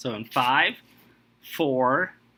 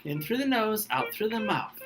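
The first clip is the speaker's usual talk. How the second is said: softer, higher soothing guidance; calming breath coaching; 6 semitones higher, 4 dB quieter than usual. Shown as a demonstration. Host calms the upset contestant with breathing, soft and soothing. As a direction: talk them down gently. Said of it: "take a breath".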